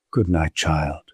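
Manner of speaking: a tired mother answering her daughter quietly; mother's good night. A narrator's reading. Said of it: "Missus Manford seemed to waver too".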